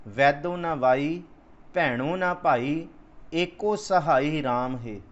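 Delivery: very slow, deliberate recital; scripture recitation. Acted. Preacher reciting gurbani slowly before explaining it. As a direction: lay out every word of the verse.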